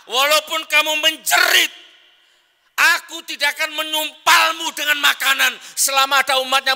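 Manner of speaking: shouting high and loud at his own stomach; angry scolding. Acted. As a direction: roar it as a furious command to his belly. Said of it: "dia marahi perutnya. Dia marah". (Umar membentak perutnya dengan marah, teriak keras)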